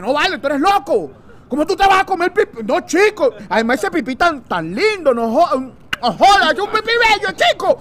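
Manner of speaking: high, loud, swooning plea; high camp pleading. Acted. Castaway begging his friend not to, high camp gush. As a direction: gush over it like a smitten admirer.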